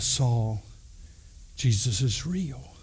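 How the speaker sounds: low, soft and slow; hushed earnest appeal. Retold or imitated. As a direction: break the news quietly, with weight on each word. Barnabas acted, finding Saul.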